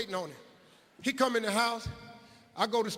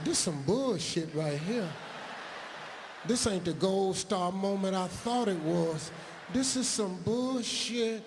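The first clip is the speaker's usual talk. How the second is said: slow disgusted mutter. Retold or imitated. Kid's disgusted inner thought.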